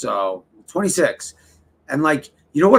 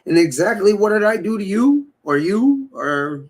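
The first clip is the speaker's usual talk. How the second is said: rising mock outrage, pitched up; mock indignant quote; 6 semitones higher, 3 dB louder than usual. Streamer quoting himself to his friends, mock indignant. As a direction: play the wronged friend, voice climbing. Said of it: "I'm like".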